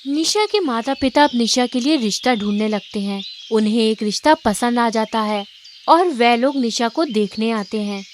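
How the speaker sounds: plain reading voice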